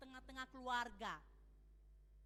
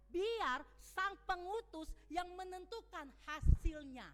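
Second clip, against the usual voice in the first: ordinary talk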